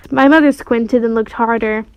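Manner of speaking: usual speaking voice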